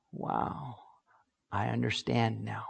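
hushed, breathless wow of understanding; awed whisper. Retold or imitated. An adult finally grasping what parents meant, awed whisper. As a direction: let the truth land in a whisper.

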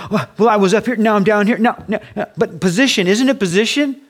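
mock flustered protest, high and loud; confused believer. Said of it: "we get all confused because".

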